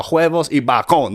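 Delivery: "bacon" as bah-KON in a Spanish phrase; confident mispronunciation. Retold or imitated. Customer ordering from the waitress, "bacon" confidently said Spanish-style as bah-KON. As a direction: order it confidently, "bacon" stressed on the second syllable. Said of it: "saying it with confidence".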